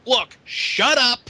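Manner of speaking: high, loud, drawn-out imitation of fed-up interrupting; mock exasperation. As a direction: cut in with a hammed-up, slow, loud outburst. Retold Booker T losing patience.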